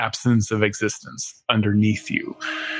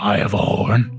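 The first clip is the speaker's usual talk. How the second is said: gravelly squirrel voice; 3 semitones lower than usual. Acted. Squirrel NPC boasts.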